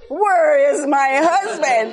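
half-laughing at the clerk, a mock angry demand. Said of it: "I wanted to reach across or grab him by the shirt".

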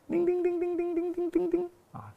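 high, soft ringing of little bells; imitated bells. Preacher imitates bells on the priest's robe, high soft chiming. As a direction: chime it out like tiny bells on a robe. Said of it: "他的袍下面还有铃的哇".

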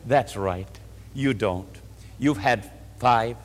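soft, low, gentle answer; tender and low with pity; kindly. Retold or imitated. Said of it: "And very kindly".

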